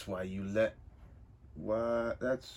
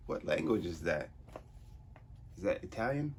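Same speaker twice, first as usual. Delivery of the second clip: ordinary talk, nothing marked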